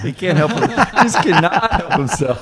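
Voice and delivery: loud, high words through real laughter; laughing hard. Real, not acted. Co-host teasing his friend over a topic, laughing.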